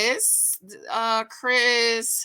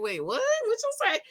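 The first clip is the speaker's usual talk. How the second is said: high, puzzled asking it back; baffled what; 12 semitones higher, 3 dB quieter, faster than usual. Retold or imitated. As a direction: play not catching a word she said. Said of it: "I was like".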